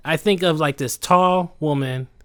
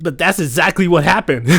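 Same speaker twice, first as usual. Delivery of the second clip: normal talking voice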